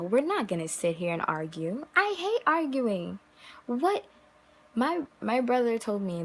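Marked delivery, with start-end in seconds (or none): none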